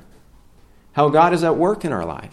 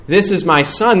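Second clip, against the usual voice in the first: a touch higher and louder, with a rising end; a hint of a booming divine proclamation; preacher lightly voicing God the Father; 5 semitones higher, 5 dB louder than usual. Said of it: "That's the heavenly Father".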